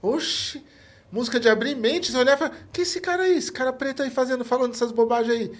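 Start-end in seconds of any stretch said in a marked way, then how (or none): 2.7-5.5 put-on disapproving old-timer. Voicing a disapproving older listener.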